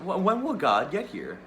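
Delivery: put-on the girl demanding an answer; insistent child. Girl repeats demanding question.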